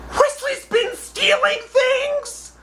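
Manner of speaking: very high, very slow outraged question; high drawn-out mock shock; appalled mom. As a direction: stretch it out in disbelief.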